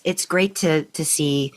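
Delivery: usual speaking voice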